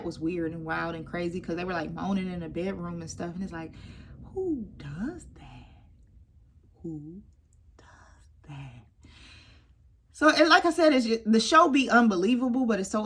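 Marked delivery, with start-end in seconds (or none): 4.3-5.8 breathy whispered aside; hushed who does that. Real, not acted. 6.8-8.9 softer whispered repeat; trailing hushed whisper. Real, not acted.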